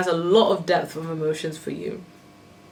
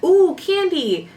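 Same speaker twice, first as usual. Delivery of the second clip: put-on very high, louder, slow, kid-like; childish voice; 12 semitones higher, 5 dB louder, much slower than usual. Reader mocking a candy-loving child, high slow squeal. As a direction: squeal it like a kid who spots sweets. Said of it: "a caricature of".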